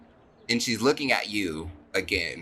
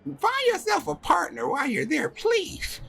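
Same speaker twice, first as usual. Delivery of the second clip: very high, insistent motherly rant; hammed-up overwrought mum, pitched way up; mother going off. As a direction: nag and plead in a squeaky high voice. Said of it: "going off now".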